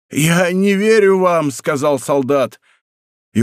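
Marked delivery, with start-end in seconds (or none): 0.1-1.5 high, drawn-out dying cry; anguished disbelief. A narrator's reading. Dying soldier rejecting d'Artagnan's oath, anguished cry. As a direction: refuse him slowly in acted agony.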